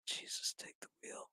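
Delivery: breathy exasperated whisper, barely voiced. Real, not acted. Reviewer watching the show, whispering in exasperation. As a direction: sigh it out under your breath like a prayer.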